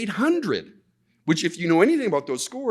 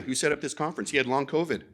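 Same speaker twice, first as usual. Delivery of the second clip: ordinary talk, nothing marked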